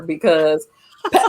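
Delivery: ordinary delivery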